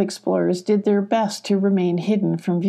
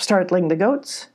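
ordinary delivery

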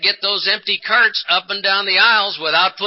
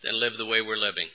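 hopeless; 7 semitones lower, 6 dB quieter than usual. Retold or imitated. Wife telling husband she'd rather die, fading to a hush. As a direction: lower and much softer, fading hopelessness. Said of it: "the only lady ... said to me".

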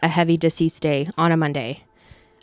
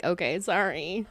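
much softer, small sheepish sorry; meek apology; 2 semitones lower, 11 dB quieter, slower than usual. Retold or imitated. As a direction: back down quietly like a scolded kid. Meek apology after being told stop.

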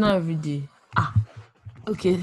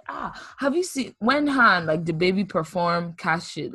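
ordinary talk, nothing marked

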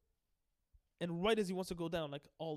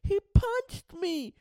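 much higher, louder whining brat, slowing down; whiny Helmeppo; 10 dB louder than usual. Retold or imitated. Spoiled son Helmeppo whining to his father about Luffy, high and loud. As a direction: tattle to daddy like a spoiled crying kid. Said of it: "Helmeppo's like".

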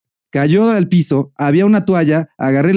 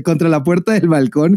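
usual speaking voice

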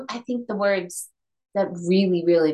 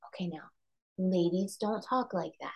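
hushed grandmother aside; 7 dB quieter than usual. Retold or imitated. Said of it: "my grandmother would pull us aside".

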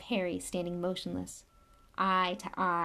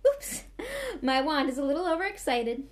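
flustered laugh; 7 semitones higher, 3 dB louder than usual. Acted. Lockhart drops wand embarrassed.

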